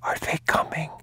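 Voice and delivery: put-on high, breathless whisper of a frightened child; a small boy asking in a tiny whisper; scared boy whispering. A hiding boy asks his father, in a scared whisper.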